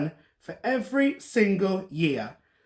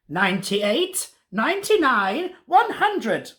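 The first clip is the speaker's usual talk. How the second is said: drawn-out count climbing much higher; counting wizard; 6 semitones higher, slower than usual. A narrator's reading. Wizard finishes counting before hunting princess, rising excitedly. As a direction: build eagerly to the last number.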